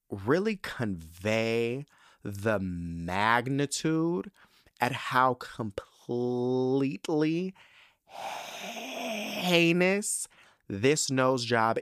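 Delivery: very slow, stretching each key word; drawn-out emphasis. Real, not acted. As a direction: draw it out for dramatic weight, rising on "heinous". Hammering home how awful it was.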